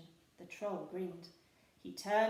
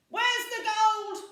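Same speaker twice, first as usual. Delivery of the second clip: much higher, loud slow roar; pirate shout; 14 semitones higher, 16 dB louder, slower than usual. A narrator's reading. Handkerchief demands gold from troll, shouting. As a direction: bellow at the troll. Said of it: "shouted Handkerchief".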